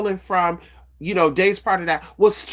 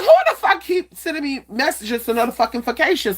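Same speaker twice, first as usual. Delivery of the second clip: higher, exasperated rant; annoyed outburst; 7 semitones higher than usual. Retold or imitated. Fed up with the alerts, exasperated rant. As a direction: vent at the phone in irritation. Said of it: "I was like".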